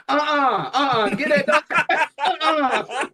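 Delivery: high, loud uh-uh, get that dog; laughing, scandalized no; loud refusal. Retold or imitated. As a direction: shoo the dog away. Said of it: "people are like".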